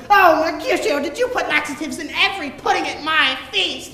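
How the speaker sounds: imitating a furious princess, high and loud; angry princess. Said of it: "with the most anger in her voice".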